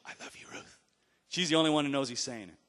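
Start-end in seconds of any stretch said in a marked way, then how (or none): 0.0-0.8 very soft, unhurried whisper. Retold or imitated. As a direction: whisper it into the mic for one listener. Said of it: "he whispers into the mic".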